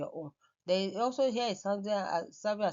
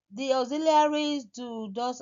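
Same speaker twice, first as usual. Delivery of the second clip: emphatic; 7 semitones higher, 8 dB louder than usual. Real, not acted. Teacher stresses key term.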